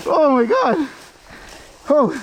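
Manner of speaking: high loud gasp of alarm; alarmed exclaim. Real, not acted. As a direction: yelp on a steep drop. Scary descent, loud.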